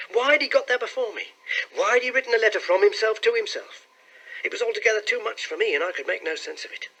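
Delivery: high, fast, agitated questioning; bewildered clerk. A narrator's reading. Clerk puzzling over why he was sent, bewildered.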